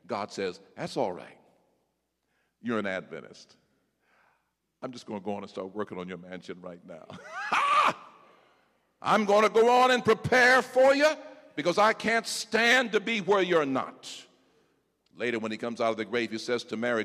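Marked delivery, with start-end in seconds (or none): 0.8-7.1 soft reassurance. Acted. God voiced softly. 7.1-7.9 a sudden high whoop of laughter; high joyful laugh. Real, not acted. Preacher laughing out with joy. 9.0-14.1 high, loud promise; loud preaching Jesus. Acted. Preacher as Jesus promising the church, high and loud.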